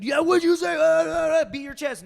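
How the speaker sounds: high, loud mock aggression; mock hothead. Mocking a man picking a parking lot fight, loud and high. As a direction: ham up a chest-beating brawler.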